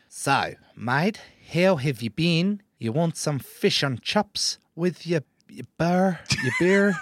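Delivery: teasing a friend's posh Kiwi phone voice; mock put-on Kiwi voice. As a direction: send up his phone voice in a Kiwi accent. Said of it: "you're putting on a voice as well".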